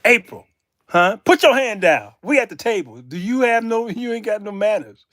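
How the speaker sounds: loud playful reprimand; mock telling-off. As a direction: scold her like a misbehaving child, hammed up. Acted telling-off of April at table.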